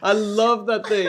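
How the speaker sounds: loud, high, through real laughter; helpless laughing delight. Real, not acted. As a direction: say it while cracking up. Cracking up at the joke.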